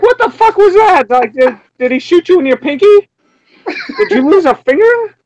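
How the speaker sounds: high-pitched incredulous questioning; exaggerated baffled reaction; mock bafflement. Mocking his reaction to odd scream.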